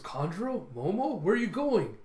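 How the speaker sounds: high, questioning imitated voice; Luffy's puzzled calling. Clueless captain seeing them leave, high puzzled calls. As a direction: call after them, not understanding. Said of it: "Luffy still hasn't picked on what's going on".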